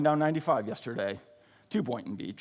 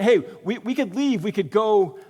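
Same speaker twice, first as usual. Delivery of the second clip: higher, louder eager pitch of a plan; excited disciples; 4 semitones higher, 4 dB louder than usual. Retold or imitated. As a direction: blurt out the idea excitedly. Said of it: "So they're saying".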